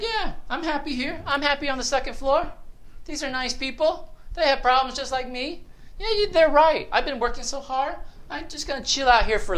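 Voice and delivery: high-pitched mock contentment; put-on high voice, easily pleased; contented patient voice. Voices patients content to stop early.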